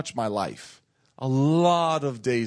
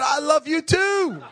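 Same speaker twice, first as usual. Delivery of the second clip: laughing reply; 11 semitones higher, slower than usual. Retold or imitated. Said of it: "I said".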